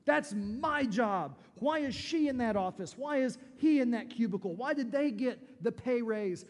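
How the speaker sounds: loud, high, indignant demands; jealous outburst. Acted. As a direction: rant like an envious coworker who missed the promotion. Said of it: "and you say".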